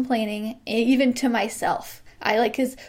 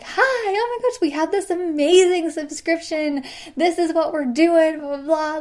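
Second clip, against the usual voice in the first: high, bubbly and louder sales pitch; excited pitch; 5 semitones higher, 3 dB louder than usual. Retold or imitated. Happy clerk selling the subscription to customers, thrilled. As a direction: gush about the offer like it's the best thing ever. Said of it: "I happen to be in a really good mood".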